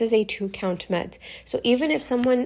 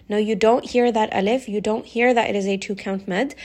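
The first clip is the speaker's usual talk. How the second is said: ordinary talk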